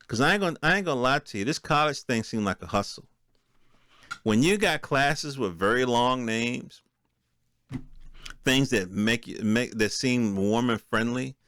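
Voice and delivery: ordinary talk, nothing marked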